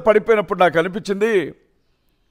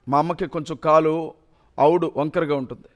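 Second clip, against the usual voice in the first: usual speaking voice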